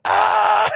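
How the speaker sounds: loud, high mock scream; imitated child's scream. Kid hears mom answer, loud mock scream. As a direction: yell out like a caught little kid. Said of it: "He screamed?".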